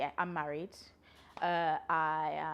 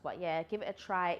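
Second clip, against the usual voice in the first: usual speaking voice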